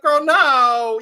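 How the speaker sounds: put-on loud, emphatic "Girl, no" to a friend; loud retort. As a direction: push it out loud like setting a friend straight. Retelling her reply to Catherine.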